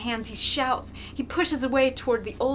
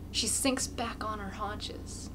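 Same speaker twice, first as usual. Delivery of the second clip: ordinary delivery, nothing marked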